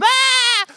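a high mock bleat of a scared lamb.

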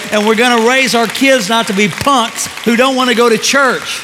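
loud, high, driving sermon delivery; full-force preaching. Real, not acted. Pastor urges church to obey God, impassioned loud preaching.

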